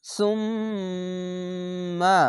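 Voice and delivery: সুম্মা with the ম held long through the nose; nasal ghunnah. Shown as a demonstration. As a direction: hum the doubled ম in সুম্মা. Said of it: "এখানে ওয়াজিব গুন্না". (গুন্নাসহ সুম্মা)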